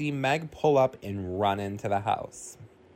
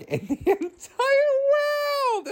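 high squealing laughter; laughing at cringy gamer talk; 5 dB louder, slower than usual. Real, not acted. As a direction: crack up mid-sentence.

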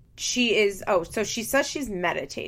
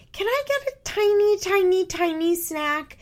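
put-on little kid voice; 11 semitones higher, 3 dB louder than usual. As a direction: beg sweetly like a small kid asking for a treat. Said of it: "in such a little kid way".

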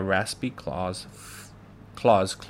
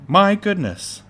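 high, loud startled exclamation; crying out; 10 semitones higher, 9 dB louder than usual. A narrator's reading. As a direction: exclaim in sudden alarm. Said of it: "Then she cried out".

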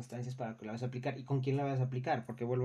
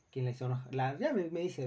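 normal talking voice

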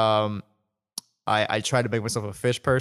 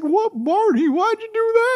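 very high-pitched, loud mock whine; Don Knotts; 7 dB louder than usual. Host imitating Andy Griffith Show cop, high-pitched Don Knotts voice. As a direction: squeal it like a skinny TV cop. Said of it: "he has, like, a high-pitched voice".

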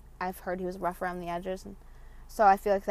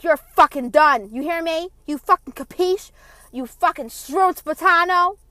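loud, high, furious mom impression; screaming Italian mom; 9 semitones higher, 14 dB louder, slower than usual. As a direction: yell the threats like an enraged Italian mother. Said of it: "Screaming at me in Italian".

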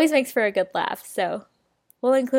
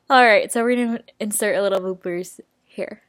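ordinary talk, nothing marked

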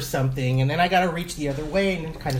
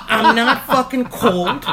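loud, slow mock outrage; acted loud irritation; exasperated retort; 5 semitones higher, 5 dB louder, slower than usual. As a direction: drag out an indignant retort. Acted angry retort to dad.

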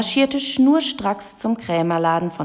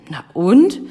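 loud, chirpy comeback; loud, carefree retort with a questioning lift; enterprising call; 9 dB louder than usual. A narrator's reading. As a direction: call it out, game for anything. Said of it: "rief sie unternehmungslustig".

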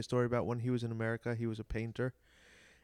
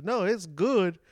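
put-on louder, higher Greek-accented old man; old painter's reply; 5 semitones higher, 9 dB louder than usual. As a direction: belt it out as the cheeky old painter. Retold old painter answering lady.

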